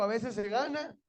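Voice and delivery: leading a call-and-response. Real, not acted. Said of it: "diga conmigo".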